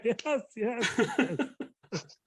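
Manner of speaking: very high-pitched mock delight; hammed-up high glee; gleeful Kim Jong-un. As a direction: squeal it gleefully, playing it for laughs.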